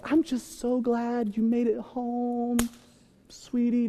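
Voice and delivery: high, soft, slow cooing; sweet doting wife. Retold or imitated. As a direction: imitate a lovestruck wife welcoming him. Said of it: "at first she's just like".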